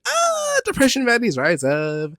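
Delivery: high, loud hype call; rally the crowd; hyped call to fellow depression baddies; rallying cry.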